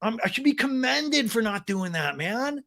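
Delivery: high, whiny self-justifying imitation; Sandoval impression. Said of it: "He's like".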